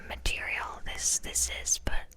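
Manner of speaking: ordinary delivery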